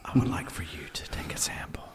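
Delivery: soft seductive whisper. Acted. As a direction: whisper it sleazily. Mock sexy Craigslist ad.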